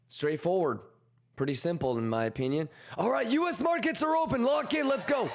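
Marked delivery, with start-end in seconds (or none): 3.0-5.3 high, fast hype call; excited. Real, not acted. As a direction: pump everyone up for the open. Market opens, hype.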